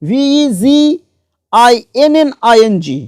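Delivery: each letter of ভিইজিআইএনএনআইএনজি drawn out for the students; spelled out slowly. Shown as a demonstration. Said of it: "তাই হয়েছে beginning".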